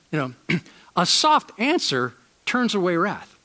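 ordinary talk

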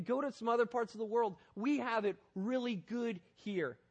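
ordinary delivery

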